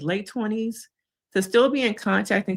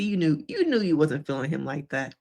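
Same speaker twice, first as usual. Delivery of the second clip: usual speaking voice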